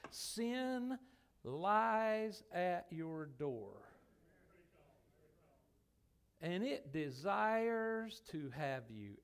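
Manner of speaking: very slow, heavy words; preacher slowing for doom; slow warning. Acted. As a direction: lay out each word like a threat. Ominous scripture warning.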